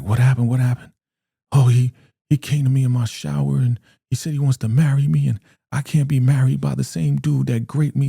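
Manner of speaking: high, agitated acted distress; frantic retelling voice. Zane going insane over the shower attack, mock frantic. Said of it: "Zane is going insane".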